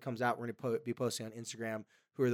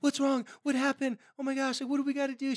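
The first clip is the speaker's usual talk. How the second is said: high-pitched, louder panicked parent; freaking-out mom; 13 semitones higher, 5 dB louder than usual. Retold or imitated. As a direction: rattle off alarmed questions way up high. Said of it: "She would've been freaking out".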